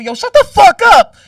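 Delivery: sudden loud, high yelled quote; mimicking someone raging online; mock angry yell. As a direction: bark it like an angry poster.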